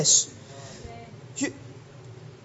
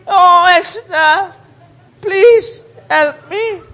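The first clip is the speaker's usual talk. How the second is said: slow, hammed-up whimpering plea; mock crying; 3 semitones higher, 5 dB louder, much slower than usual. Haman begs Esther, in hammed-up mock crying. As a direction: beg like a melodramatic crybaby. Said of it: "And he's crying".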